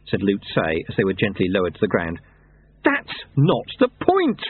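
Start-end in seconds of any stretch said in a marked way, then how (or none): 2.8-4.4 drawn out, high-pitched heated protest; slow, rising indignant objection; hotly. A narrator's reading. Lobsang objects to beheading the Yeti, heated and slow. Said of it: "said Lobsang hotly".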